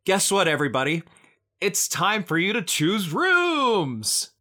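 high, slow game show call; excited announcement. Acted. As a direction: announce it like a prize. GM announces to players.

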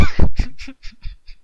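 high unvoiced laugh; burst of laughter. Real, not acted. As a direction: break into laughter. Laughing after his joke.

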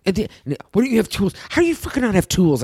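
retold yelling. Man scolding her for not having tools, mock yelling. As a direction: imitate his exasperated yell. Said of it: "Yells at me for not having tools".

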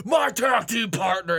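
drawn-out, half-shouted wrestler announcement; Braun Strowman. Acted. Imagining a wrestler revealing his tag team partner, mock shout. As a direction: boom it out slowly like a big wrestler. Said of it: "if Braun Strowman is like".

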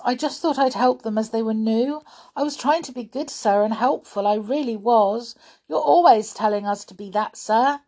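high, earnest excuse; pleading pupil. A narrator's reading. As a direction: plead your case to the teacher. Voices Winks pleading.

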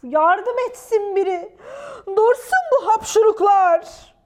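loud, high bellow for help; hammed-up lion plea; roaring lion. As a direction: wail and roar as a suffering lion. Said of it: "diye kükredi aslan".

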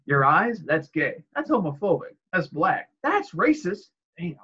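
quick retorts leaping up in pitch; acted sitcom exchange. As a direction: build to a high, indignant punchline.

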